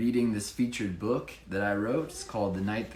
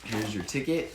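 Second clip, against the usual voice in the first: plain reading voice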